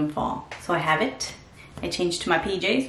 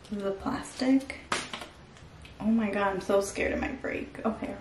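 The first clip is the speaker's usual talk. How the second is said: whispering; 9 dB quieter than usual. Real, not acted. Careful with the face mask.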